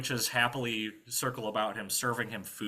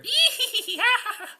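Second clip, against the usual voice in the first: high, loud witch cackle; witch's cackle; 10 dB louder than usual. Acted. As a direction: cackle shrilly like a storybook witch. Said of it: "witch's laughter".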